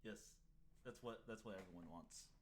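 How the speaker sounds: ordinary delivery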